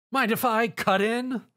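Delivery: high, slow snarky quip. A narrator's reading. Zio quips at the monster, slow and snarky. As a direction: tease him as you strike. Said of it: "he snarkily asked".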